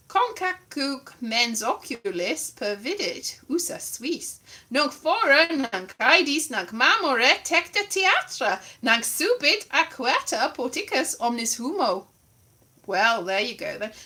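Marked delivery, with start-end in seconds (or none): 0.1-12.1 Latin read aloud in a bad accent. Reading Ovid to the audience, bad Latin accent. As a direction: declaim Latin with a dodgy accent. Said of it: "bad, bad Latin accent".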